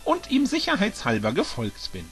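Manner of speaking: höhere, entschlossene Frauenstimme; Birdo, resolute Dinodame. Acted. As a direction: droht fröhlich-bestimmt von oben herab. Birdo-Figur hält höhere Stimme. (Birdo warnt Bowser mit höherer, resoluter Stimme)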